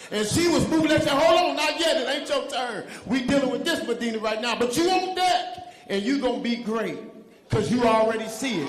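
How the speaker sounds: high, loud, fast preaching; fired-up preaching. Real, not acted. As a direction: preach it full force.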